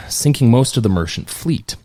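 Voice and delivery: ordinary talk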